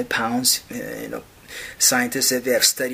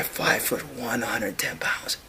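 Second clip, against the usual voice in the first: hushed pity; soft hushed repeat; whisper; 3 semitones lower, 8 dB quieter than usual. Real, not acted. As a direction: whisper it mournfully.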